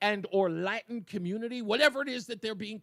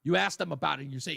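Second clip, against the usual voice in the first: ordinary talk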